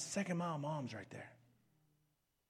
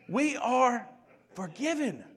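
loud, high preaching, driving every line; full-force preaching; 19 dB louder than usual. Acted. Pastor drives the fairness point home, preaching at full volume. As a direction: preach it hard to the congregation.

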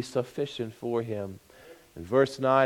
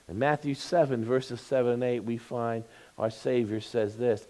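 ordinary delivery

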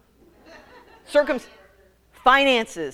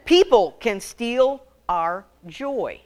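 ordinary delivery

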